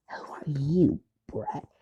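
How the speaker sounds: whispery menacing villain voice; creepy whispered sneer. Retold or imitated. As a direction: whisper it with sinister contempt. Voicing the scary villain Shigaraki.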